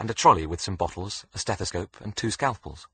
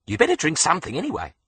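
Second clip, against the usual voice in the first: high, loud bossy Aussie nurse; Australian nurse; 10 semitones higher, 5 dB louder than usual. A narrator's reading. Nurse pressing water on Alex, high and bossy. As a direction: insist brightly like a nurse.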